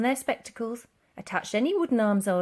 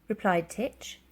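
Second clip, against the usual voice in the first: ordinary delivery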